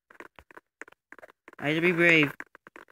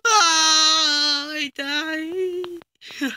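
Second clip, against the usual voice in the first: dying wail; 7 semitones higher, much slower than usual. Real, not acted. Player just killed in the game, drawn-out wail. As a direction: wail it out and let it fade.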